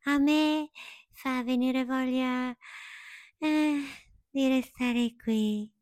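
high, light butterfly voice. Acted. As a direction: float it out like a dreamy butterfly. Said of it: "e sussurrò".

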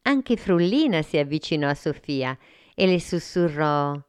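ordinary delivery

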